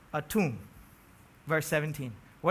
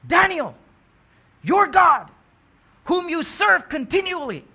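"Daniel, your God" cried out loud and high; loud proclamation of "your God"; loud proclaim; 9 semitones higher, 9 dB louder than usual. Acted. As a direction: ring out "your God" like a herald. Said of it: "He says".

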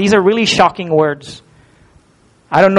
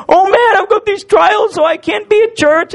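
mock whining excuse; 12 semitones higher than usual. Preacher mocking a churchgoer who skips church over trials, whiny. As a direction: parody a self-pitying believer, sobbing.

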